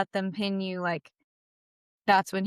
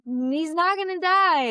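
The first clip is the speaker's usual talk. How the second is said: higher, louder, stubborn denial; insistent denial; 9 semitones higher, 4 dB louder than usual. Retold or imitated. Telling the class her dad will live, insistent denial. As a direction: refuse to believe it, lightly retold.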